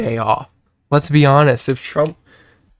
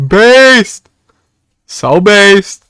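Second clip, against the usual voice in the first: higher and louder cry of based; excited shout; 4 semitones higher, 5 dB louder, much slower than usual. Real, not acted. To chat hyping up a clip, an excited shout. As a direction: cheer the word based out. Repeated word, building to a shout.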